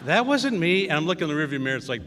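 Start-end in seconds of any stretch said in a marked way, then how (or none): 0.1-0.9 put-on girl's denial. Susie denies the fart.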